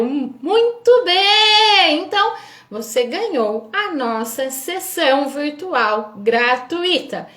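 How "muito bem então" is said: loud, high, stretched "muito bem"; excited. Real, not acted. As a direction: celebrate the winner, drawn out. Announces the winner.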